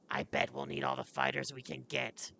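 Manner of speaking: reply as a gruff fighter; gruff warrior. Acted. Plays a game character.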